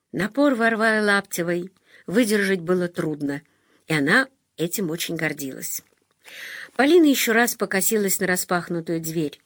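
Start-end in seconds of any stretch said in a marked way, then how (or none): none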